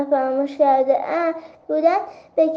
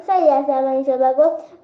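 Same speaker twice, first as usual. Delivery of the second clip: ordinary delivery, nothing marked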